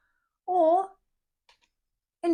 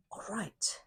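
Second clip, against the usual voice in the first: whisper; 4 semitones lower, 14 dB quieter than usual. Real, not acted. Whispers before the spread.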